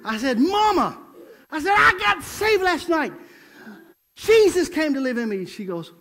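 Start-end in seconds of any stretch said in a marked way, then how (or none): 0.4-1.0 high, loud call to mother; acted boyish excitement; excited son calling. Excited son tells his mother news. 1.8-5.4 high, loud proclaiming, drawn out; acted, over-eager excitement; excited announcement. Excited son tells his mother news.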